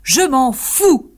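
loud, high, very slow angry retort; angry outburst. Acted. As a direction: throw it back at her, furious. Mariano snaps back. (riposte furieuse, forte et très lente)

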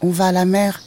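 high, thin kitten voice; petite voix de chaton. Acted. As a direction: call out as a tiny kitten. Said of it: "une petite voix derrière elle". (toute petite voix aiguë de chaton)

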